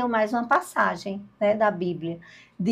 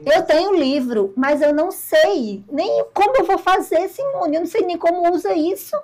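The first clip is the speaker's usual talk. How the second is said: flustered confession; 5 semitones higher, 8 dB louder than usual. Retold or imitated. Panicked admission about the book.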